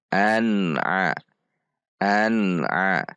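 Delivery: an-'a joined, N clear; clear izhar. Shown as a demonstration. Teacher models practice word, an-'a clear and joined. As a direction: join an to throaty a without bouncing or droning. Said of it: "namun diizharkan".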